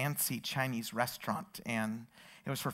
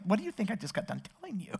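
quick, higher, disbelieving question; incredulous; 5 semitones higher, 4 dB quieter, faster than usual. Retold or imitated. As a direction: imitate someone who can't believe you asked. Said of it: "he looked at me incredulously".

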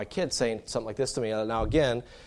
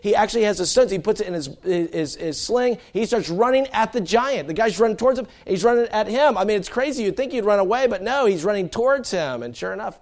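loud, high, driving sermon delivery; preaching at full force; 10 semitones higher, 8 dB louder than usual. Acted. Preacher thundering the Goliath story at the congregation, full force.

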